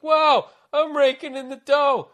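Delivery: high, loud, slow gushing at a tiny raise; hammed-up greenhorn thrilled with his pay; naive delight. Busboy mocking his younger self, high and gushing.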